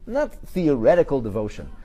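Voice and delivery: ordinary talk, nothing marked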